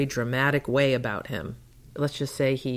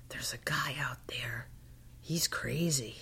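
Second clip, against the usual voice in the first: whisper; 10 dB quieter than usual. Retold or imitated. Diner owner confides about stranger.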